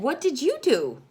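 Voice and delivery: usual speaking voice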